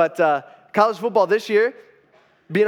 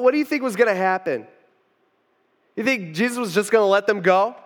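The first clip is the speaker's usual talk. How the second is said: normal talking voice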